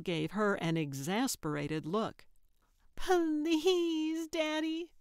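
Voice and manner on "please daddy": put-on high, whiny little boy plea; begging child. A narrator's reading. Carl begging his father for the cat, pleading. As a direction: beg like a tearful small kid. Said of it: "Carl begged".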